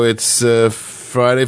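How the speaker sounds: ordinary talk, nothing marked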